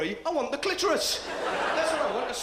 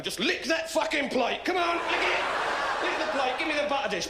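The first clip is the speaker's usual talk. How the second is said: encouraging dad; 7 semitones higher than usual. Pretend. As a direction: egg them on, raised. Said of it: "I'm gonna be there at the dinner table".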